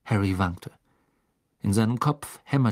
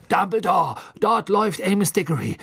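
raised, high, loud voice; urgent call; 7 semitones higher, 4 dB louder than usual. A narrator's reading. Fudge spotting Amos Diggory approaching, urgent call. Fudge calling Dumbledore's attention.

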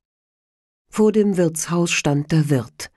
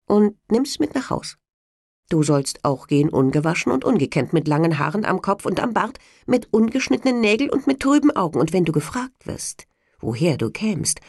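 devil; 4 semitones higher than usual. Acted. Devil gives Hans orders.

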